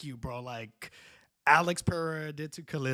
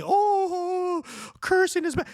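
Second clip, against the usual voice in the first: very high, louder mock-prissy scolding; mocking whine; 4 dB louder, slower than usual. Mocking the official who banned cursing, high and scornful. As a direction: imitate a scold with a drawn-out high "oh".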